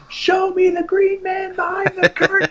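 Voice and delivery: high, loud, slow shrieking demand; mom screaming. Retold or imitated. Mom yelling at the call center guy, high and loud. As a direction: scream it like a mom who won't be brushed off. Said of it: "My mom screaming at this guy".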